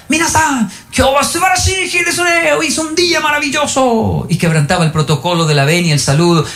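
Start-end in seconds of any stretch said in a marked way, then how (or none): 0.1-4.2 gritting-out teacher shout. Retold or imitated. Japanese teacher entering the classroom, shouting exuberantly. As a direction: imitate an exuberant teacher's yell. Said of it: "cuando entraba al salón gritaba".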